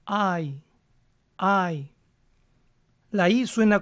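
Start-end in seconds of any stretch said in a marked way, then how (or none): 0.1-1.9 "I" like a Spanish i. Shown as a demonstration. Teacher demos the diphthong ending, careful. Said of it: "suenan como nuestra I".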